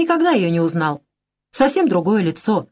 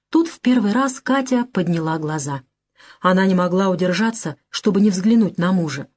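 ordinary delivery, nothing marked

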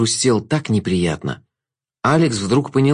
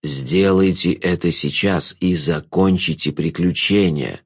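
low, slow villain's voice; low, deliberate provocation; goading Jester; 6 semitones lower, slower than usual. A narrator's reading. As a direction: egg her on in a low drawl. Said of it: "подначивал Шут".

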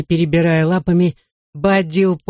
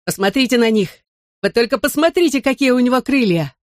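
high-pitched cry of wonder; excited calling; 7 semitones higher than usual. A narrator's reading. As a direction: urge everyone to look, pitch climbing. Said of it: "крикнул я".

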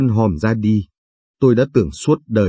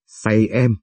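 a hint of a tender husband teasing; tender flirt.

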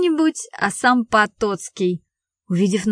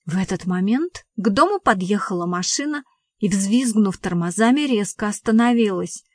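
ordinary delivery

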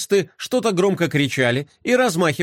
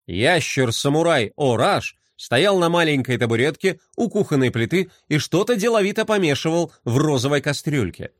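usual speaking voice

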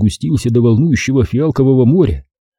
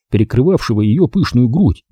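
plain reading voice

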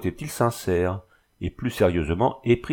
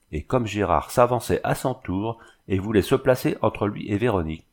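plain reading voice

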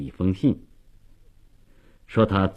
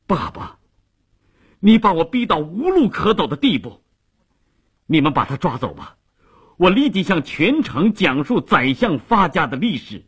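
high-pitched, furious threat; desperate anger, pitch soaring after the first word; angry cry; 8 semitones higher than usual. A narrator's reading. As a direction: cry out in rage at your father. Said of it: "愤怒地喊着".

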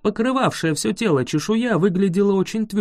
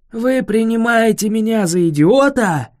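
offended dragon; 5 semitones higher, 5 dB louder than usual. A narrator's reading.

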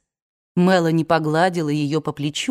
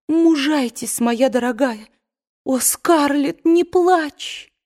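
higher, tender consoling; comforting; 8 semitones higher, slower than usual. A narrator's reading. Melanie consoles Scarlett, tender and close to tears. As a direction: hold back your own tears.

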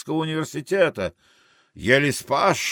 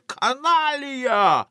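high, long-drawn cry; sailors' shouted insult; 13 semitones higher, much slower than usual. Acted. Italians shout at Arthur, high drawn-out insult. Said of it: "Итальянцы кричат".

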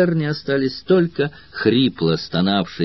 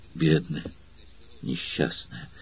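breathy, much softer, almost voiceless pity; trembling with emotion; 8 semitones lower, 12 dB quieter than usual. A narrator's reading. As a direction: whisper his pity for his poor sister. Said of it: "дрожащим от волнения голосом произнёс".

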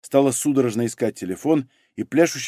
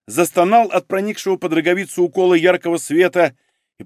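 usual speaking voice

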